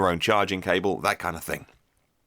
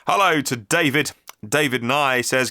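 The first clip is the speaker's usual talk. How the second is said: ordinary talk, nothing marked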